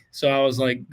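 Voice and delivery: ordinary talk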